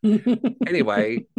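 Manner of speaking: laughing, drawn out. Real, not acted. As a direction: laugh it off slowly. Laughs, then moves on.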